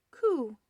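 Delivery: high, happy little coo; contented baby coo. A narrator's reading. Baby ghost in a clean diaper, contented coo. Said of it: "said baby ghost contentedly".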